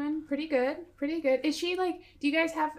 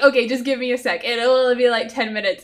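louder, quicker, hold them off; insistent brush-off; 10 dB louder than usual. Acted. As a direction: wave the questioner away fast. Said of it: "So I'm like".

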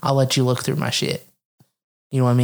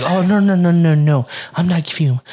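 hammed-up rush of denials; higher, louder mock protest; mock panicked backpedaling; 4 semitones higher, 6 dB louder than usual. Imitates partners hastily backing down.

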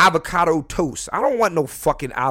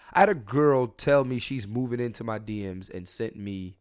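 ordinary talk, nothing marked

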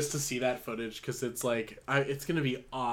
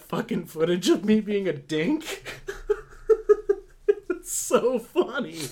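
laughing; 8 semitones higher, 3 dB louder than usual. Real, not acted. Laughing at his own story.

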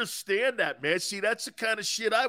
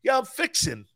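mock Southern wife; 6 semitones higher than usual. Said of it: "my wife goes".

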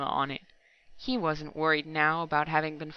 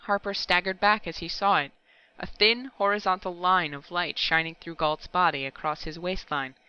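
usual speaking voice

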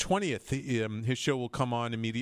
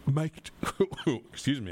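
ordinary delivery

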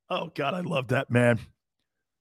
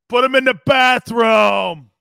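slow, loud shouted order; full hammed-up yell of the movie line; 4 semitones higher, 10 dB louder than usual. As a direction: bellow the order across the room.

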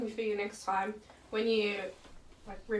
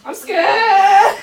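excited squeal; 10 semitones higher, 19 dB louder than usual. Real, not acted. Nervous squeal before tasting.

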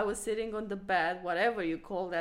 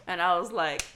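normal talking voice

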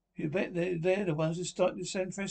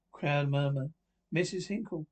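ordinary delivery

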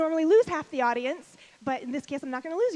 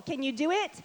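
usual speaking voice

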